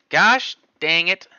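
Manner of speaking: yelling in frustration at a missed trade; scream. Real, not acted. Frustrated trader screams at the chart. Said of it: "Sorry if I screamed in your guys' ears".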